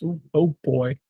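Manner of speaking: normal talking voice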